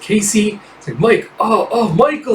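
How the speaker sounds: hammed-up mock passion; breathy lovers' calls. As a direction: call names in mock passion. Lovers calling names.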